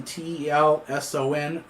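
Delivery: slow letter-by-letter spelling; slow dictation of the street name; spelled out slowly. Real, not acted. Spelling out the address.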